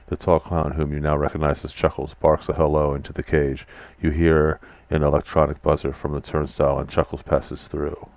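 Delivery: steady storyteller reading of game text; story narration. A narrator's reading. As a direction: read the scene out like a narrator. Reads the game's room text.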